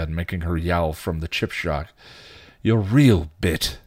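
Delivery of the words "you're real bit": louder, irritated retort; a touch of snarl, punching it. A narrator's reading. Spike, fist to his temple, snarling. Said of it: "he snarled".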